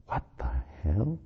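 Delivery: hushed private thought; inner thought. A narrator's reading. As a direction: think it to yourself, barely voiced. Her unspoken thought.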